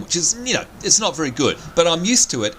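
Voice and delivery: ordinary talk, nothing marked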